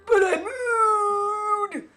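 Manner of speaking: very high, quavering with coughing fits; high crackly old man, coughing; doddering old alchemist. Acted. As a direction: wheeze out a welcome like an ancient alchemist.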